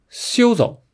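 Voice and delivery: high, sharp challenge to halt; challenging call. A narrator's reading. Wukong halts the fleeing little demons, high challenging call. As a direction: call out to stop them, pitched high.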